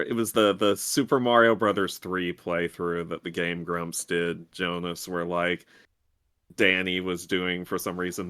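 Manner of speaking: ordinary talk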